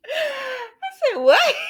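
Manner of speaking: very high squeal through laughter; laughing disbelief. Real, not acted. Host reacts to baby left with stranger, laughing disbelief. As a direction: squeal it out while cracking up.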